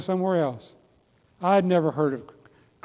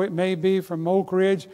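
ordinary talk, nothing marked

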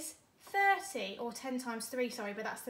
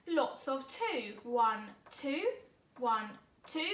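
ordinary talk, nothing marked

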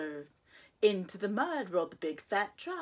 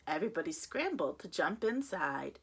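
plain reading voice

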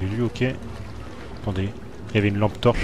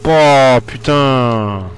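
drawn-out relief; 3 semitones higher, 10 dB louder, much slower than usual. Real, not acted. After a scare, drawn-out sigh.

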